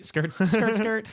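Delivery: high skidding-tire noise voiced as words; tire screech imitation. Teller imitating the car skidding through town, high tire screech. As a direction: mimic tires screeching round a corner.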